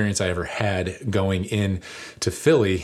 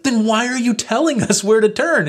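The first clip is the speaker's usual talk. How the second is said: much higher and louder outraged question; exasperated; 6 dB louder than usual. Retold or imitated. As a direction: vent the frustration at him.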